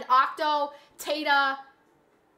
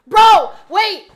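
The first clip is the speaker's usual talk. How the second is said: sudden shout; 6 semitones higher, 16 dB louder than usual. Real, not acted. Sees the leaderboard change.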